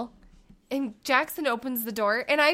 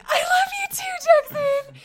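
much higher and louder, breaking into a sob; teary gush; 4 dB louder than usual. Retold or imitated. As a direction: pour out joyful, tearful love to the toddler. Mom moved by toddler's words.

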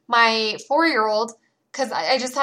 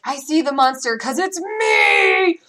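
slow mock monster roar for the kids; playful monster; 6 semitones higher, 5 dB louder, much slower than usual. Mom playing monster for her kids, slow playful roar. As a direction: lumber out and growl to scare the kids. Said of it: "I'm like".

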